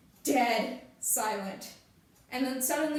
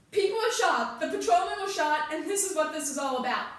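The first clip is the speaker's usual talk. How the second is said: raised, high voice easing down; heated insistence; 4 semitones higher, 4 dB louder than usual. Acted. Heated questioning over the shot patrolman, forceful raised voice. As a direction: insist forcefully under pressure.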